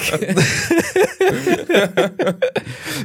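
a long, high, rising laugh; real long laugh. Real, not acted. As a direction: let the laugh roll on and climb. Laughing at the neighbour joke.

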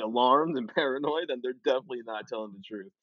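ordinary talk

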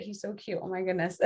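hushed, fond murmur to someone nearby; soft cooing aside. Real, not acted. Guest turns aside, cooing softly at someone cute. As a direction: coo softly at something adorable.

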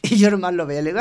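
ordinary talk

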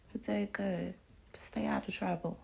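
soft whispered praise. Real, not acted. Whispering encouragement to her friend. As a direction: breathe it like a private word.

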